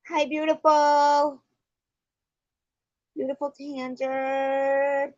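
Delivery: high, loud, gushing over-sweet voice; mock a gushing YouTuber cooing at everyone; gushing YouTube girl. Imitating a YouTube girl cooing to her viewers, high and gushing. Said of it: "And I was like".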